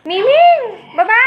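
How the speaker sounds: sharp loud yell, high. Real, not acted. Owner yelling at the pet Miming to get down, loud yell. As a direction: shout the name to make her stop.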